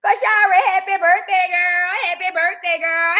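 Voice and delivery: high, loud birthday cheer; excited birthday wish. Real, not acted. Host wishes viewer happy birthday, excitedly calling it out. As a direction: shout out the wish with real excitement.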